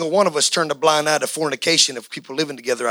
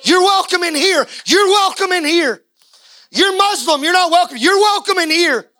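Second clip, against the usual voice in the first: higher, driving sermon voice with shouts; full-force preaching; 12 semitones higher, 4 dB louder than usual. Acted. Preacher rousing the congregation, high and fervent. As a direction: preach it hard and high to the rafters.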